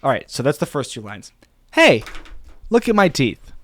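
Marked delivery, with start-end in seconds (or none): 1.7-3.5 punchy high-pitched attention grab; high, emphatic script read; very emotive, expressive. Shown as a demonstration. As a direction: deliver it like an expressive internet hook. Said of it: "this is very emotive, very expressive".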